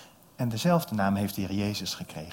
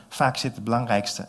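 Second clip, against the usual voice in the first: ordinary delivery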